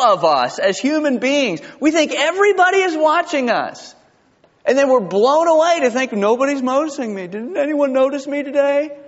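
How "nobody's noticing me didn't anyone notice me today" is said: high, plaintive mock complaint; mock dismay. Preacher mimics a churchgoer nobody noticed, mock dismay. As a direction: play the ignored churchgoer, pitched way up.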